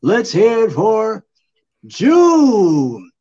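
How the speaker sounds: long stretched high announcer shout; boxer announcer voice. Pretend. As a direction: belt out the fighter's name. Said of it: "boxer announcer voice".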